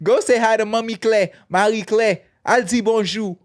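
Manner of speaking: high, loud Haitian mom bragging; proud Haitian parent showing off; Haitian accent. Retold or imitated. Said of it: "I just put the Haitian accent on".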